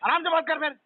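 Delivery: high-pitched, indignant protest; irritated demand. Real, not acted. Irritated customer repeats his demand.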